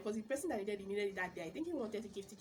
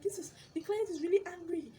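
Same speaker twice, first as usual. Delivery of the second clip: upset complaint; 9 semitones higher, 5 dB louder than usual. Retold or imitated. Reseller's complaint retold.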